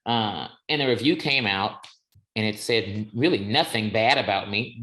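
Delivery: usual speaking voice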